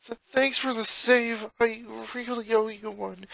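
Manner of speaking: put-on high boy voice, a slight stammer; mild shaky gratitude; shaken thanks. Boy thanking his sister for the rescue, mildly shaky. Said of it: "replied hesitantly".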